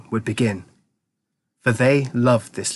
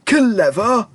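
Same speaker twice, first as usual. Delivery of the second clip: put-on high, louder scoff of an old man; old man's cry; 10 semitones higher, 4 dB louder than usual. A narrator's reading. Grandpa Joe crying out at Charlie, high and loud. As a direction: cry out the word in disbelief. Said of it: "cried the old man".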